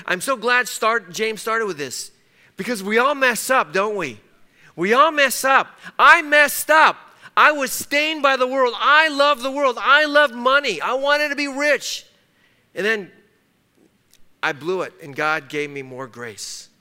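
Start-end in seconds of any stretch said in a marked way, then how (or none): 2.6-12.1 loud, higher preacher's confession; preaching at full force. Acted. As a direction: cry out his own failures to the congregation.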